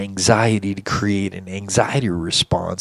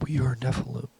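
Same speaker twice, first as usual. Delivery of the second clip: a higher, whispered alien chorus; scary alien voice; 4 semitones higher, 11 dB quieter, faster than usual. Retold or imitated. Aliens at his bedside answer him, soft whispered alien voice. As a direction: hiss it softly like four aliens at once. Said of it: "they said in, like, scary, alien, high-pitched, deep voice".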